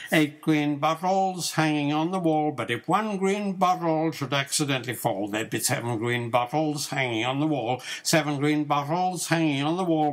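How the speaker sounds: ordinary delivery, nothing marked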